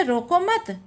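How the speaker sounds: "रोको मत" high as one unit, then a break; pause after मत. Shown as a demonstration. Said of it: "coma है".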